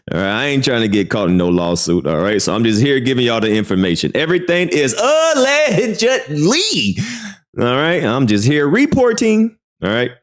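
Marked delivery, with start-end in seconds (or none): 4.2-7.0 much higher, slow sing-song "allegedly"; playful high drawn-out hedge; mock disclaimer. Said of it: "as a news reporter". 7.6-9.6 a touch of a higher newsman voice on "reporting"; lightly hammed-up broadcaster; mock news reporter.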